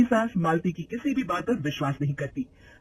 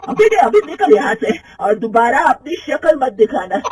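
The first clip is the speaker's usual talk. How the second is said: much higher and louder, slow scornful tirade; furious scolding; 6 semitones higher, 9 dB louder than usual. Acted. Mother-in-law throwing out the thieving manager, loud furious scolding. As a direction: drive the thief out with fury.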